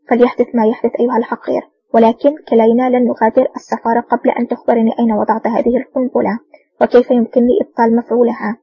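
plain reading voice

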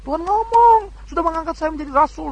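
quick, high, self-assured claim of a deluded man; mock deluded claimant. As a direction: rattle off the claim as if it were obvious.

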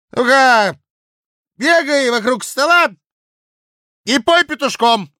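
put-on loud, high drunken bluster; drunken blustering official. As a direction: boast it loud like a tipsy clerk. Drunk Kozulin commands.

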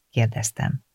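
ordinary delivery, nothing marked

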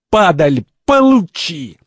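high, loud furious outburst; boiling over. Acted. Second slaps the cardinal, boiling with anger. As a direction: hurl it out with the slap. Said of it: "второй вскипает и отвешивает кардиналу увесистую".

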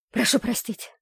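slightly out of breath. A narrator's reading. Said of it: "чуть задыхаясь от спешки".

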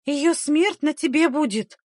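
high-pitched, forceful accusation; accusing. A narrator's reading. Midwife pointing at the aunt, accusing. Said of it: "в обвинительном жесте".

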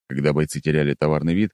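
usual speaking voice

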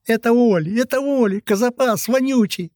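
put-on very high, whiny boy's wail; tearful wailing. A narrator's reading. Beaten boy telling on his attacker, tearfully wailing. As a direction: wail it high like a tattling child. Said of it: "плачущий голос Гвинда".

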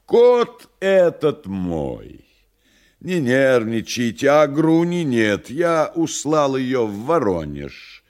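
loud, high, smooth stranger; a lightly voiced sinister guest; devil's voice. Woland's voice from the bedroom.

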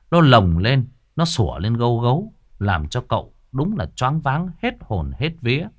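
plain reading voice

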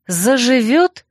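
high rising shocked question; alarmed exclamation. A narrator's reading. Heroine learning Koyl's wound is untreated, alarmed. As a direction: catch the word in disbelief. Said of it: "воскликнула я".

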